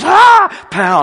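loud high cry of spiritual power; loud shout. Retold or imitated. Preacher praying over the woman, loud high shout. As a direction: blast it out like a hit of power.

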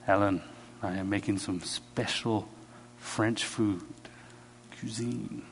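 soft, low phone invitation with lingering pauses; hushed invitation. Retold or imitated. Friend phones Alan about special French cooking, hushed and enticing. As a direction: dangle the offer softly, piece by piece.

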